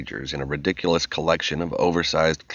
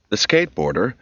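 usual speaking voice

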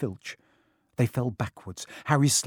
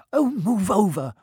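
higher, impatient snarl; 8 semitones higher, 4 dB louder than usual. A narrator's reading. Hermione pushing past her friends, snarling. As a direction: snap it, pitched up and irritated. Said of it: "Hermione snarled".

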